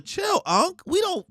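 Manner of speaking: put-on very high kid's squeak; squeaky kid voice. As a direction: talk down to an old man like a kid. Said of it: "They hit him with a".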